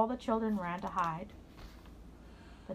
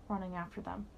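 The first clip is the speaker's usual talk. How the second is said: ordinary delivery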